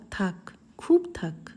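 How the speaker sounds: whisper. Retold or imitated. Narrator's soft reply to master.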